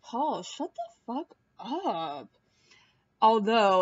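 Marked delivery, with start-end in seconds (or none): 0.0-2.3 slow, fed-up mock retort; deadpan fed-up slow retort; exasperated. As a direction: drag it out like you've had enough. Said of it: "And I was like".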